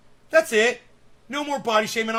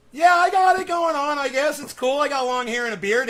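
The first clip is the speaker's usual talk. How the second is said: mock cocky; 7 semitones higher, 6 dB louder than usual. Real, not acted. Boasting jokingly.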